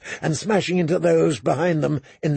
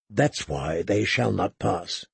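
low, slow, softer vow; quiet, measured defiance; grim resolve; 7 semitones lower, 5 dB quieter than usual. A narrator's reading. Grim resolve about the defenders.

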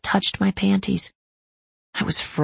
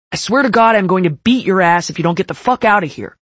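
loud furious threat; angry threat; 2 semitones higher, 7 dB louder, faster than usual. Acted. Nash threatening cousin.

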